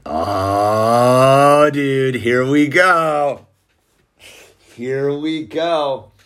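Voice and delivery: long drawn-out "oh", slow words; slow, hammed-up here-comes-trouble groan; retold knowing dread. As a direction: stretch every word out in mock dread. Dad's retold knowing reaction.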